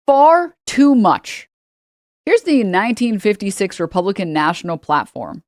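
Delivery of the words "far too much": FAR and TOO MUCH drawn out, high and loud; "far too much" stretched out with heavy loud stress; mock-grave emphasis. Real, not acted. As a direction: punch each of "far too much" slowly. Sarcastic emphasis on the claim.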